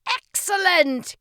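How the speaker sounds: slow, high, booming praise; cheering. A narrator's reading. King praises the boy's call, cheering. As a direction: stretch out a big loud cheer. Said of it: "King Shumiwami cheered".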